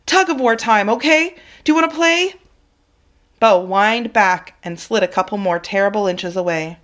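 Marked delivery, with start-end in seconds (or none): 0.1-2.4 high, bright-as-possible invitation; forced playful coaxing. Acted. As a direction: sell the game to save him. Coaxing trapped dog to play.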